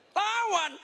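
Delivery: very high mock-startled cry; feigned shock. Preacher pretends to discover the word, feigned shock. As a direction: pretend you just found out, voice shooting up. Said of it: "Baru tahu, pura-pura kaget loh".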